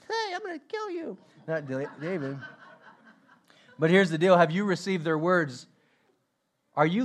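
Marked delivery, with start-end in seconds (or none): 0.1-1.2 high thin squeak, mocking himself; teasing high kid voice; squeaky junior high voice. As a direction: squeak it out like a boy whose voice hasn't dropped.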